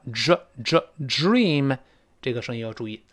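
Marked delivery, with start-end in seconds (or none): 0.1-1.8 dream 的 dr- 拆开慢读; dr sound. Shown as a demonstration. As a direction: stretch out the dr- in dream. (慢读 dream 的 dr- 音)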